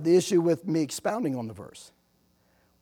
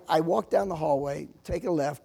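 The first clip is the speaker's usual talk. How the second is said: ordinary talk, nothing marked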